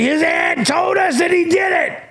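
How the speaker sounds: high mocking voice of critics; mocking quote. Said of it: "are gonna be like".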